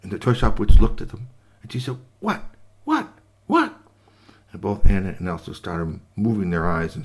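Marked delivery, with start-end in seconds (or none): 2.2-3.8 rising startled what. Acted. Witch startled by the banging dolls, high. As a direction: blurt it out, shocked.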